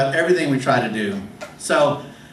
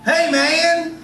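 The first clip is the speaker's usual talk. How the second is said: high mock call from across the lot; 11 semitones higher than usual. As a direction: shout it across a parking lot. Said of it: "this voice calling out from behind me".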